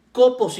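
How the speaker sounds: kopos mit Betonung auf dem ersten O; stress on first O. Shown as a demonstration. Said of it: "con acento en la primer O".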